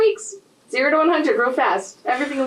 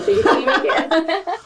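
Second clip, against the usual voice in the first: laughing; 6 semitones higher, slower than usual. Real, not acted. Joking about theater majors.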